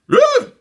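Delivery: very high loud squeal of disgust; high squealing ugh; shrieked ugh. A narrator's reading. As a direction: shriek at the bugs. Dawn disgusted.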